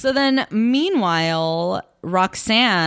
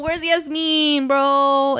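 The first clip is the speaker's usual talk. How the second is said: higher, slow mock outrage at the show; an exasperated higher echo; 7 semitones higher, much slower than usual. Real, not acted. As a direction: draw it out higher in disbelief. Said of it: "Where the fuck is Yasmin?".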